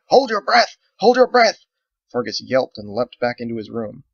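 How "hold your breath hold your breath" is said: high, loud urgent shout. Acted. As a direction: warn everyone at full voice.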